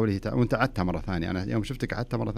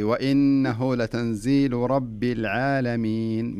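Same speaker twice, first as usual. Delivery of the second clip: very slow, louder; slow recitation; 3 dB louder, much slower than usual. Shown as a demonstration. Teacher modelling the verse slowly for caller. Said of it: "ارجعي، ارجعي".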